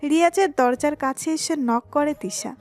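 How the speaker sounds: ordinary talk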